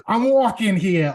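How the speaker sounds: put-on a quick New York accent bit. Anchor asks him for his New York accent. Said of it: "practicing a New York accent".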